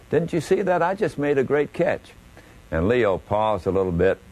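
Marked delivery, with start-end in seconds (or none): 0.1-2.1 higher, squeaky and pleading. Retold or imitated. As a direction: squeak it out, eager for praise. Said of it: "in that high, squeaky voice".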